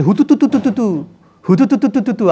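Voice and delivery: put-on mother coaxing a baby to eat with sing-song hututu; playful baby chant. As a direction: chant hututu to coax a child. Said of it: "হুতুতুতুতু বলে দৌড়াচ্ছে".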